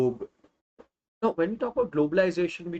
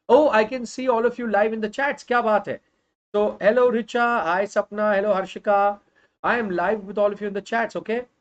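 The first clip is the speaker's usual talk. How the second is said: excited greeting; 5 semitones higher, 6 dB louder than usual. Real, not acted. Greeting viewers in live chat.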